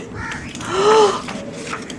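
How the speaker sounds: sharp unvoiced gasp; a storyteller's dramatic gasp. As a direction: draw in a startled breath.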